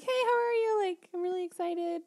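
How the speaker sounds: high, chirpy eager greeting; bubbly and excited. Retold or imitated. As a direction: gush like a cheery friend on the phone. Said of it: "normally I'm like".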